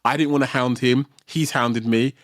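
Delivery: ordinary talk, nothing marked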